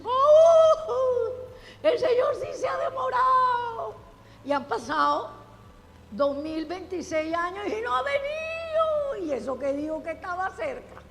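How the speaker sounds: high, loud scoffing voice with a laugh; mocking the worldly. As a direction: mimic a scoffer jeering at the promise. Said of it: "eso es lo que dice el mundo".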